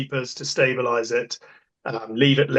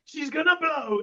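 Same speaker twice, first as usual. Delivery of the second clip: much higher, slow, strained cry of alarm; Kevin's alarm; 13 semitones higher than usual. Retold or imitated. Kevin warns the crew as the tanker tips, high slow alarmed cry. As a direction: act out Kevin seeing the tanker tip. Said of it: "and Kevin went".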